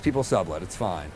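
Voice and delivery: dropping soft, talking himself into it; self-convincing mutter. Retold or imitated. Guy reassuring himself about the shady apartment, softly. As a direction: quietly reassure yourself. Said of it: "But I'm like".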